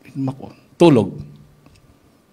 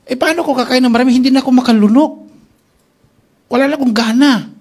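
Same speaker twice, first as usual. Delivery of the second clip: pleading complaint; 9 semitones higher, 5 dB louder, faster than usual. Retold or imitated. Sick man refusing food to doctor.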